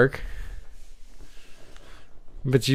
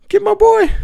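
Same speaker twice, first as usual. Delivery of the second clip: high, loud rooting shout; root for the rescue, high and loud; excited cheer; 7 dB louder than usual. Real, not acted.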